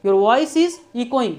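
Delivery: slow and clear, each word spaced; slow model phrase. Shown as a demonstration. Teacher to students on a bad line, slow clear phrase. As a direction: drill it slowly for learners. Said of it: "तो वहाँ आप बोलेंगे".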